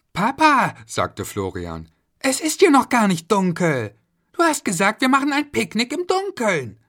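put-on high kid's voice, a bit whiny; a kid pointing out it's not dark; complaining child. Florian complains to his dad, high and whiny.

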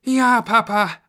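put-on high, loud eager kids; children answering. A narrator's reading. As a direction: answer brightly as obedient children. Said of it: "antworteten die Kinder".

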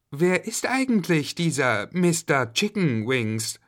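lightly voiced curious girl; a hint of a puzzled child; Julia asking. A narrator's reading. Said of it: "fragte Julia".

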